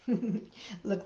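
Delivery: ordinary delivery, nothing marked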